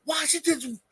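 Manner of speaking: high, shocked announcer shout, slowing to the end; hammed-up outraged play-by-play call; wrestling announcer. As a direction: play the stunned ringside commentator. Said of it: "it's gonna be like this".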